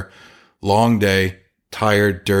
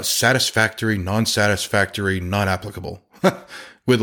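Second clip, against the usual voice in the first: ordinary talk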